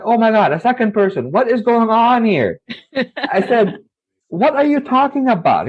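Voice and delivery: high-pitched, stunned retelling; alarmed disbelief. Retold or imitated. Boy to the classmate sent by the jinn, alarmed disbelief. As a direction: sound thrown that it's happening twice.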